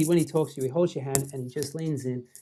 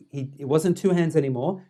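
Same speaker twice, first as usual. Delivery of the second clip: ordinary talk, nothing marked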